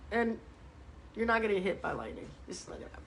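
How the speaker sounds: dropping to a near whisper, quick; a quick hushed tease; hushed aside. Real, not acted. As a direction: mutter it under your breath. Teasing aside to a viewer.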